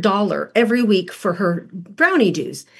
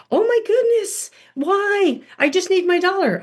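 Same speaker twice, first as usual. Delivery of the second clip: put-on higher child voice, pleading why; whiny child protest; 10 semitones higher than usual. Daughter pleading for her dollar at school, high-pitched and whiny. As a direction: play the little girl begging, pitched high.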